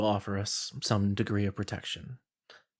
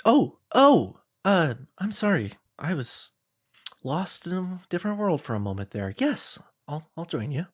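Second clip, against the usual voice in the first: higher voice, startled out of a daydream; flustered villager; 7 semitones higher than usual. Acted. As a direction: come back apologetically, pitched up and hesitant.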